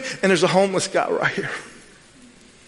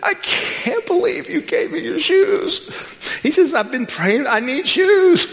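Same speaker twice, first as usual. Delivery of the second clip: much higher, tearful imitation of the grateful man; imitating crying homeless man; 11 semitones higher than usual. Preacher imitating the homeless man crying his thanks, much higher. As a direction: play the homeless guy crying, voice lifted high and wet with tears. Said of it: "He starts crying".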